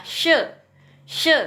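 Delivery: short, falling "she"; "she" as a jerked falling-tone syllable; snapped fourth tone. Shown as a demonstration. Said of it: "Jadi disentak".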